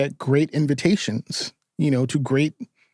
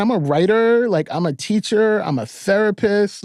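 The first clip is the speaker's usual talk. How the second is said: high-pitched, insistent protest; comic exasperation, pitched well up; exasperated refusal; 7 semitones higher than usual. Retold or imitated. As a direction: plead your case, listing reasons emphatically. Retold refusal of the journey's order.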